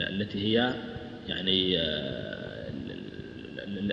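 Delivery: ordinary delivery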